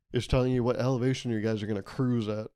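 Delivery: usual speaking voice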